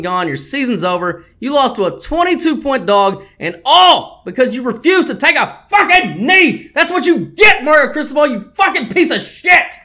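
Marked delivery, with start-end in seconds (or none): 1.4-9.7 swearing tirade. Real, not acted. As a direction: vent at the coach, all out. Ranting at the coach.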